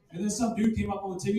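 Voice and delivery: ordinary talk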